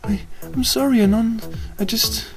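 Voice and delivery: high, soft apologetic muttering; small sorry pony voice; mildly teary mutter; tearful mutter. Acted. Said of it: "she muttered".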